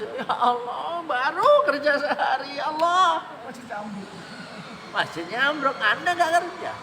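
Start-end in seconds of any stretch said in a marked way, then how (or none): 0.0-4.1 high, wailing mock lament to God; hammed-up weepy plea over the collapsed mosque; mock crying. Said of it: "Kita akan nangis".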